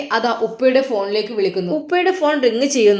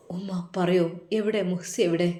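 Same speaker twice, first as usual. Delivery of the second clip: lower, softer tearful asking; 4 semitones lower, 7 dB quieter than usual. Acted. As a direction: son with tears begging to know where his wife is. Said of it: "അവൻ്റെ കണ്ണുകൾ നിറയുന്നതായിട്ട്". (കണ്ണീരോടെ താഴ്ന്ന ചോദ്യം)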